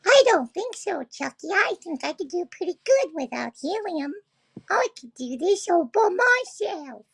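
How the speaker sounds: high-pitched squeaky put-on voice; mock helium squeak. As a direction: pipe up as if on helium, without any. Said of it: "I think Venom needs a helium tank".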